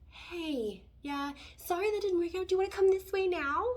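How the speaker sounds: high, fast cheery invitation; sweet universe. Pretend. The universe sweetly invites you back, high and quick. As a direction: coax them back kindly. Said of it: "the universe is like".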